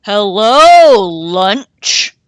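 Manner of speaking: loud gleeful wolf voice; big wolf roaring a greeting; wolf shout. A narrator's reading. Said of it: "a big wolf shouted".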